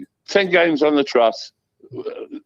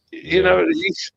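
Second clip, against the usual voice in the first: ordinary talk, nothing marked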